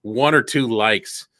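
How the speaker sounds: ordinary talk, nothing marked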